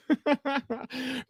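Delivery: a real, high burst of laughter; a sudden laugh at himself. Real, not acted. Host cracking up at his own accent slip.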